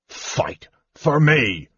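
slow, outraged dwarf, rising high; sputtering rage. A narrator's reading. Fallen dwarf answering kender, sputtering rage. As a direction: sputter it out in indignant disbelief. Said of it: "sputtering with rage".

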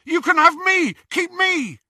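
high, loud pleading; desperate shout. A narrator's reading. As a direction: beg loudly. Ron pleads.

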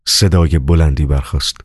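plain reading voice

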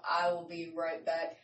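ordinary talk, nothing marked

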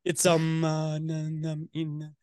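awkward robot-like stammer; Elon Musk. Acted. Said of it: "awkward voice because he definitely talks like a robot".

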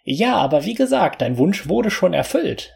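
high, squeaky little fairy; a tiny fairy speaking. Acted. Narrator voices the fairy.

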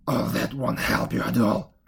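low, put-on old woman's voice; scolding witch. A narrator's reading. Old woman silencing the weeping Gretel, low and scolding.

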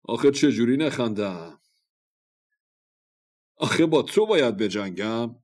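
loud, scoffing warrior; amused, loud disbelief at a puny rival; laughing mockery. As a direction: jeer as if holding back laughter. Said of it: "همین جور که خنده به لب داشت گفت".

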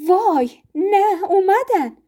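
high, slow panicked gasp; flustered. A narrator's reading. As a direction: caught off guard by the doorbell. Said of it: "سراسیمه گفت".

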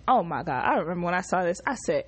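normal talking voice

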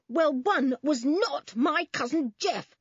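high, loud, slow insistence; fierce. A narrator's reading. Toby defending his cousin to the policemen, fierce. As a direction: hammer each word out fiercely. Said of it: "said Toby fiercely".

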